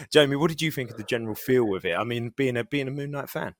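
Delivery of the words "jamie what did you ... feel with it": ordinary delivery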